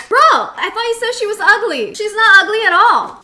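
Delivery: loud tactless friend blurting it out; loud bro voice. Retold or imitated. Friend's loud comment quoted.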